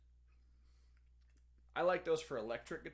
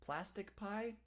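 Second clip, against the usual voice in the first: ordinary delivery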